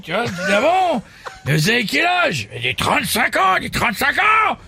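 high, laughing crude comic; Jean-Marie. Acted. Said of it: "un ami des grosses têtes, Jean-Marie".

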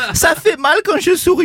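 mock German accent, high and laughing. Comedian slips into a laughing German accent. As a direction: put on a German accent mid-joke. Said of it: "Je sais pas pourquoi je fais un accent allemand".

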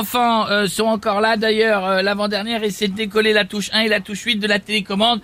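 housewife character voice. Acted. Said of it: "Madame Pélissard avec nous".

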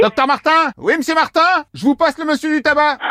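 high-pitched staged call-out; calling the doctor over. Pretend. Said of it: "le docteur vient d'arriver".

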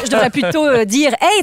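ordinary talk

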